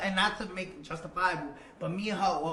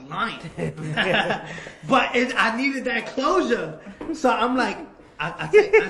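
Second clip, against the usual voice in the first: laughing hard and loud through the words; real laughter; 5 semitones higher, 12 dB louder than usual. Real, not acted. Laughing to the host about lying to his girlfriend. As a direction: crack up while admitting it.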